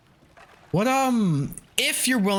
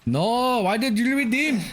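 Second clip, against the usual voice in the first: higher, exaggerated Indian-accented question; mock Indian accent; 9 semitones higher than usual. Streamer repeating the scam-baiter's line to chat, mock Indian accent. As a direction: quote it again in a put-on Indian accent. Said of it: "Accents are funny".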